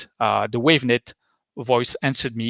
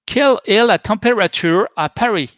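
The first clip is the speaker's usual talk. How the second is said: French in a thick, put-on British accent, higher pitched; 7 semitones higher, 4 dB louder than usual. Shown as a demonstration. Presenter demos voice search to the audience, thick British accent in French. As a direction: ask it in French like a heavily accented Brit. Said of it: "but with a British accent".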